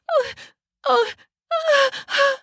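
high, breathy short cries; small high "oh"s with each jolt; crying out. Acted. Wife jolted by each impact, crying out. Wife struck, nearly losing balance.

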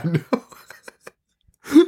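high-pitched, breaking into laughter; laughing. Real, not acted. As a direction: say it through real laughter. Laughing along at the joke.

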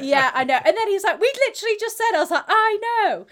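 high, loud, giddy exchange; both of them can't believe it; excited disbelief. Retold or imitated. Couple marvelling at the coincidence, high and excited. Excited back-and-forth with boyfriend.

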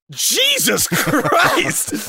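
loud, high, slow shocked cry; shocked exclamation. Retold or imitated. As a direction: gasp it out in disbelief. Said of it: "I looked at my wife and I go".

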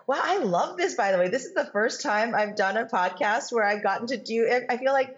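bright lift of delight at a fresh question; light up, genuinely thrilled. Real, not acted. Guest delighted to be asked a listener question, excited.

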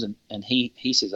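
ordinary talk

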